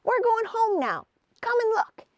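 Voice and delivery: high, eager little gnome; a lightly voiced excited gnome. A narrator's reading. Woody inviting Hazel to see the raft, high eager voice.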